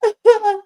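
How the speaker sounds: sudden high, loud burst of laughter; high cackling laugh. Real, not acted. Host reacting to a crude joke: high loud cackle. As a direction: crack up at the joke.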